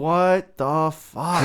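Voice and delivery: slow drawn-out disbelief; stunned. Real, not acted. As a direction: stretch it out in shock. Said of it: "Why does my nose hairs make you think of that".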